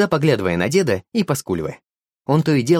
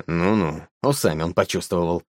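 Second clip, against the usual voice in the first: smirking; 6 semitones lower, 4 dB quieter than usual. A narrator's reading. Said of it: "усмехнулся дед".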